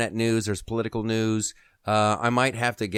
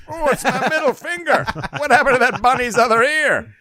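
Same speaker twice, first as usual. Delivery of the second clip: high, loud feigned astonishment; mock surprise; 12 semitones higher, 6 dB louder than usual. Driver making a rude shadow puppet for tailgater, mock surprise. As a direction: act hammily amazed by your own puppet.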